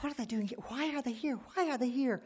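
high-pitched bewildered inner questioning; alarmed disbelief. Retold or imitated. Hiding in the cubicle from her parents, acted alarm.

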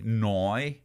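drawn-out Northern Irish "now"; Northern Irish accent. Retold or imitated. Showing how the north says it, Northern Irish accent. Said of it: "people from the north of Ireland say".

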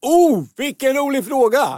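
loud, high mock-excited exclamation; exaggerated delight. Co-host reacting to a listener's question, exaggerated delight. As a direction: gush with over-the-top delight.

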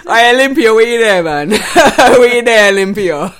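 loud, high playful shout; calling out loudly. Real, not acted. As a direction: shout across to a friend. Calls out to absent friend.

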